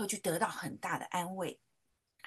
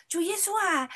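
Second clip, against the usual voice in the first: put-on a higher, childlike prayer voice; child praying; 9 semitones higher, 4 dB louder than usual. Little daughter praying in Sunday school, high childlike voice. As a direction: sound like a little girl praying. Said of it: "他就祷告说".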